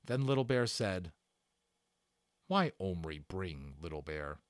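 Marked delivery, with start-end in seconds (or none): 2.5-4.4 lower, slow, grave chief voice; a lightly voiced chief asking gravely, low and slow; Indian chief. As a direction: ask it slowly, like a proud chief. Indian chief Little Bear speaks.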